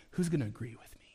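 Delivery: secretive whispered question. Retold or imitated. People online looking for agreement, whispering.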